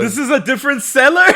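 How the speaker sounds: loud, high, incredulous question; real surprise. Real, not acted. Co-host learning of another cellar, loudly incredulous. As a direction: burst out quickly in amused disbelief.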